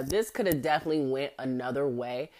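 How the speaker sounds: ordinary talk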